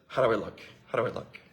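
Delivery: coy question to husband; fishing for praise. Retold or imitated. Wife asks husband how she looks, softly coaxing.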